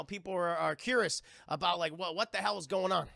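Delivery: ordinary talk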